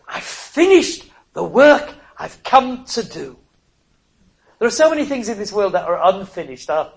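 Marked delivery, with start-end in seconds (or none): 0.1-3.4 triumphant declaration. Acted. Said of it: "He was saying".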